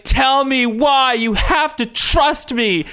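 high, slow, hammed-up demand; mock melodramatic pleading. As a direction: stretch it out as an overacted plea. Acts Xavier's melodramatic demand.

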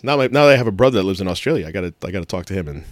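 ordinary talk

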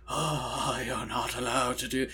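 soft, breathy mock whisper of the actor; quiet imitation of an effete villain; Eddie Redmayne impression. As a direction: hush it down like a fussy villain. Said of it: "And Eddie Redmayne goes".

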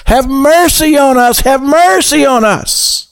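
high, pleading cry for mercy. A narrator's reading. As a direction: plead high and stretched, lifting it toward Jesus. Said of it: "they began to cry out to him".

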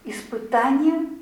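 ordinary delivery

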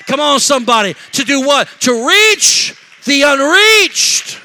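loud preaching building to a drawn-out shout; full-force preaching. Acted. Preacher rousing congregation to reach the unreached, full-force preaching climax. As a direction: climb to a climax, stretching the last words.

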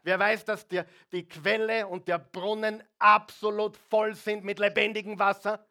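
loud, high rising sermon call; fiery preacher climbing in volume; preaching at full force. Acted. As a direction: rouse the congregation.

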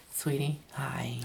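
slow hushed funeral whisper. Acted.

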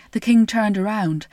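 usual speaking voice

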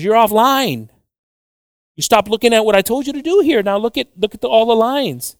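high-pitched exasperated reproach; acted high, insistent telling-off; retold scolding. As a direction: imitate a boss pointing out the mistake. Retold guy scolding the driver.

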